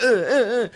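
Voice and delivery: high-pitched mock yelps of pain; imitated flinching grunts. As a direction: grunt repeatedly as if recoiling from blows.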